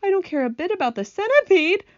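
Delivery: high, loud tearful protest; mock sobbing. Earthworm weeps for James, hammed-up sobbing protest. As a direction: wail it high as if through sobs. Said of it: "the earthworm sobbed".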